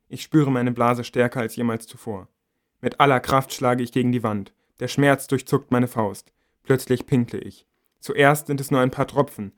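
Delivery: usual speaking voice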